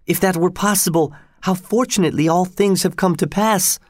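high, hopeful outburst; exclaiming. A narrator's reading. Young woman overjoyed at the rescue plan, exclaiming. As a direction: let the relief pour out. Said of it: "Solange exclaimed".

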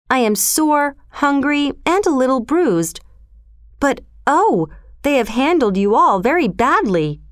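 put-on a boy talking to his bear friend; young Mowgli. A narrator's reading.

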